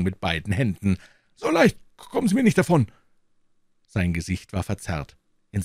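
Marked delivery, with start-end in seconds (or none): 1.4-2.9 put-on high, loud, threatening old man; wildly agitated. A narrator's reading. Professor blocking the door, threatening in high loud fury. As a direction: bar the door in frenzy. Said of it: "in wilder Erregung".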